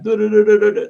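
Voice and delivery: high, loud playful pixie trill du-du-du; pixie humming. Retold or imitated. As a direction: chirp it like a mischievous little pixie teasing. Pixie going round humans.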